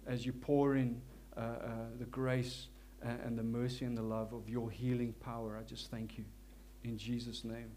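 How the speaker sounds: ordinary talk, nothing marked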